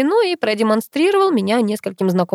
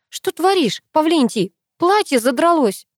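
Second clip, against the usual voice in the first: high, lamenting complaint; wailing protest; 8 semitones higher than usual. A narrator's reading. Girl carried off by her friend, wailing about her dress. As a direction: lament it in a raised pitch. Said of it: "запричитала я".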